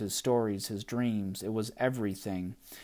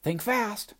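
high, taunting doll shout; Gum Baby voice; 11 semitones higher than usual. Acted. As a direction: taunt like a tiny brat. Gum Baby throws goop.

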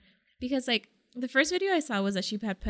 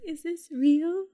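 stunned disbelief; 7 semitones higher, 3 dB louder, slower than usual. Retold or imitated. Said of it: "And I was like".

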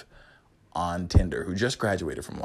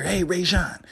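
higher-pitched mock hail; calling out; 10 semitones higher, 4 dB louder than usual. Student greeting his friend at school, calling out. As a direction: imitate someone calling to a friend.

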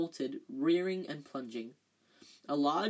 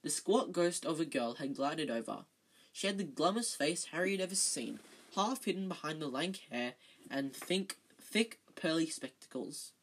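ordinary delivery

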